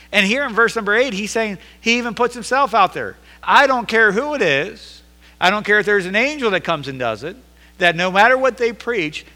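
loud, high, driving pulpit delivery; fiery sermon climax; full-force preaching. Acted. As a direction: preach it hard to the congregation.

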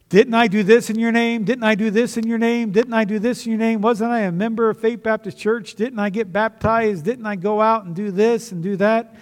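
loud, high, insistent mock protest; indignant mock pleading. Acting out self-justifying pleas at heaven.

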